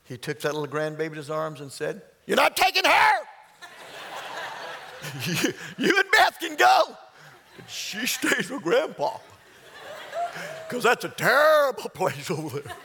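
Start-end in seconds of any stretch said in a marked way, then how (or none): 2.3-12.8 high, loud grandpa shouting through laughter; protesting grandpa. Retold or imitated. Grandpa holding the grandbaby, refusing to let her go, laughing loud protest. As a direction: playfully put your foot down as grandpa.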